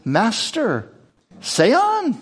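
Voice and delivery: high-pitched mock deference; insincere, exaggeratedly high politeness; oozing insincerity. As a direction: draw out a falsely polite, high reply. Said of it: "insincerity oozing from his very words".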